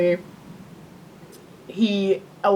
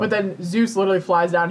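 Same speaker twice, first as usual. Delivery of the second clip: normal talking voice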